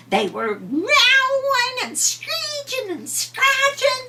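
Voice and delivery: high, loud, slow cat screech. A narrator's reading. Narrator mimics the fighting cats, screeching high and slow.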